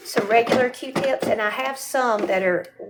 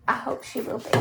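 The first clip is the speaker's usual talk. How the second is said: whisper; 3 semitones higher, 7 dB quieter, faster than usual. Real, not acted. Whispered aside off camera.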